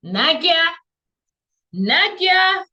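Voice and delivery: high, loud repeated call; rising excitement, almost a squeal; excited exclamation. Real, not acted.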